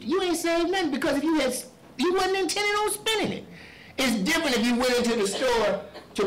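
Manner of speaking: loud, high-pitched sermon cadence; fiery preaching. Acted. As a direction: preach it hard to the congregation. Preaching at full force.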